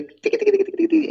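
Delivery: riff agudísimo y rápido hecho con la boca; imitación de guitarra. Retold or imitated. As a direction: imita un riff de guitarra en un tiquitiqui muy agudo. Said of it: "empieza ese sonido de". (imitar guitarra, muy agudo)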